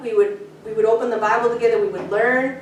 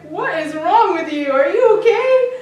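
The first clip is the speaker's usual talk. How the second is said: high, rising alarm; blurted worried question; startled question; 6 semitones higher, 5 dB louder than usual. Retold or imitated. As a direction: ask what's wrong, half alarmed. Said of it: "And I'm like".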